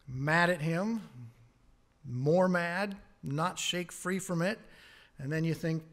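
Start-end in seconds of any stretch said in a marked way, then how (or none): none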